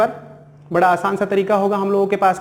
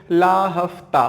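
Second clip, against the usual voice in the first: slow mnemonic; slower than usual. Shown as a demonstration. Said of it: "कैसे याद रखें".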